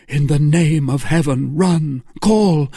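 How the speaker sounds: high, loud, desperate commands; frantic plea. A narrator's reading. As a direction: shout for help in panic. Frantic lover begs friends for help.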